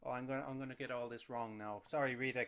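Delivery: usual speaking voice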